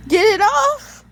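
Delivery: high, loud panicked cry to get it off; panicked shout. Acted. Girl panicking at the shackle on her wrist, loud panicked cry. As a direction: yell it in panic, voice jumping up high.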